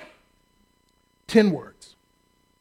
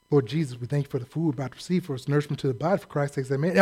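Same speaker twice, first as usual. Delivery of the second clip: fast, softer mock grace mumbled by rote; rattled-off rote prayer; 4 semitones lower, 5 dB quieter, faster than usual. Preacher mocking a rote mealtime prayer, fast and softer. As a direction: rush through it like a bored grace. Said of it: "you're just saying things to keep yourself awake".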